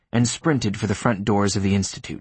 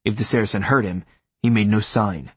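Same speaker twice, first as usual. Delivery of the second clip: plain reading voice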